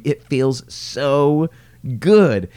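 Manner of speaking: stretched, relishing so good; savoured delight. Real, not acted. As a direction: draw out the joy, then land it.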